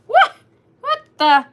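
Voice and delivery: high, loud, startled yelp; exaggerated startled reaction; mock shock. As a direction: act out stunned disbelief. Retelling her stunned reaction.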